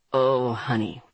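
Andre's voice. A narrator's reading. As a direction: read him low and cool.

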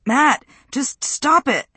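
high, drawn-out plea; urgent pleading cry. A narrator's reading. Josie begs Matt to stop the fight, high urgent cry. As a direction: call out sharply to pull him off.

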